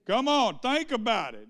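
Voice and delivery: exhorting. Real, not acted. Preacher exhorting congregation.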